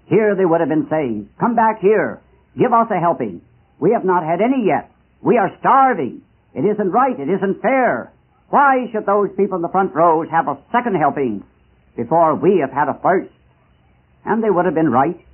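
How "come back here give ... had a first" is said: raised pitch, insistent phrases from the hungry back rows; acted indignant plea; vigorous protest. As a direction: cry out against the unfairness. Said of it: "protesting most vigorously".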